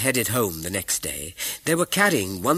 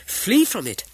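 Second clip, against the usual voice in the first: high, louder urging to flee; urgent cry; 12 semitones higher, 6 dB louder than usual. A narrator's reading. Wife urges husband to shun corruption, high urgent cry. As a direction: cry it out, pitched up and pressing. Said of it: "cried his wife".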